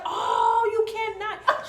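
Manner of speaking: high, loud mock shock; playful disbelief. Mother shocked by daughter's remark, high and loud. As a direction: gasp it out in delighted disbelief. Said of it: "I'm like".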